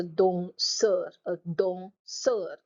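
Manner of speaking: "danseur" slowly as don - seur; syllable by syllable. Shown as a demonstration. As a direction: break "danseur" after the nasal syllable. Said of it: "split your word into syllables".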